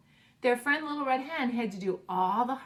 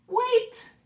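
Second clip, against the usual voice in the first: much higher, louder exclamation; sudden cry; 14 semitones higher, 9 dB louder than usual. Acted. Bear stops his friends in alarm, a sudden loud cry.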